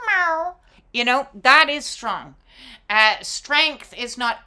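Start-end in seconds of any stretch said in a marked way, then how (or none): 0.1-0.6 playful meow. Pretend. Cat noise after lion bit.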